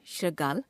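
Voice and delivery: श्रृगाल as "shragaal", r with short a; wrong pronunciation. Shown as a demonstration. Teacher showing a ri error, श्रृगाल said as "shragaal". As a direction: drop the i sound from श्रृगाल. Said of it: "अशुद्ध".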